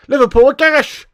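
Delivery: high, loud jeer; jeering shout. Real, not acted. United fan insulting Liverpool, jeering shout.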